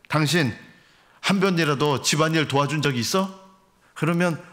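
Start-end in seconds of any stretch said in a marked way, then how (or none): none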